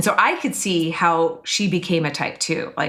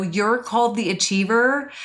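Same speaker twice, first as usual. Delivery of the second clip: normal talking voice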